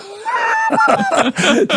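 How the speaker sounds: loud, high laughing shout; cracking up at his own punchline; real burst of laughter. Real, not acted. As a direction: let the laugh explode out.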